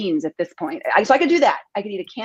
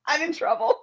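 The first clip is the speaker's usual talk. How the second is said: high, laughing despair; 10 semitones higher than usual. Real, not acted. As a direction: laugh through the words. Laughing about empty fridge.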